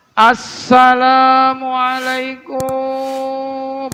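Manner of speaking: high, loud, drawn-out singsong beggar's call; beggar's greeting. Retold or imitated. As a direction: call it out long like a beggar at the door. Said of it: "tukang minta-minta ya salamnya". (salam panjang melengking ala pengemis)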